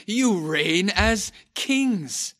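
loud, slow sarcastic acclaim; high, drawn-out mock homage; biting sarcasm. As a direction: hail them mockingly, stretching every word. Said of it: "He says sarcastically".

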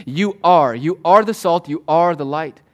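loud, firm stress on "are"; insistent declaration, landing hard on "are"; emphatic affirmation. Shown as a demonstration. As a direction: hammer each "are". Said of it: "begins with an emphatic affirmation".

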